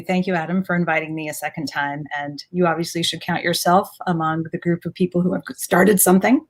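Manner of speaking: usual speaking voice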